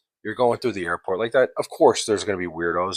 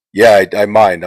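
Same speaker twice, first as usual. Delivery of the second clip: louder, flat-out no to the lady; firm loud refusal; 9 dB louder than usual. Retold or imitated. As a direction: say I mind like the matter is closed. Refusing the seat-switch request.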